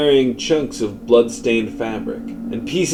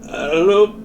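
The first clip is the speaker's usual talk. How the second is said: higher, strained and unlike his own voice; strained, not his own voice; 7 semitones higher than usual. Acted. Man answering himself aloud, higher and strained. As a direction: push it up out of his normal register. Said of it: "doesn't sound like his own voice".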